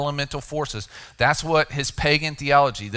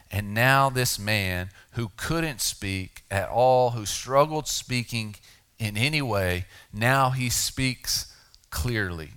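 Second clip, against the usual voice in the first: slowed emphasis; 2 semitones lower, slower than usual. Real, not acted. Emphatic slow sermon climax.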